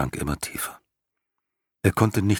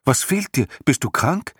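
higher and louder, anxious questions; worried; 9 semitones higher, 4 dB louder than usual. A narrator's reading. Boy Atreo anxiously asks his sick horse, worried and louder. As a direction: press him with worried concern.

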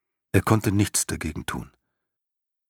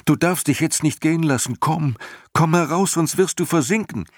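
much higher, louder frantic pleading; desperate shouting; 11 semitones higher, 4 dB louder than usual. A narrator's reading. Boy Atreo shouts at his sinking horse not to give up, frantic. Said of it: "schrie Atreo".

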